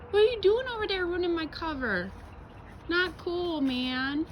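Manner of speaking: squeaky scolding of the dog; high-pitched scolding. Real, not acted.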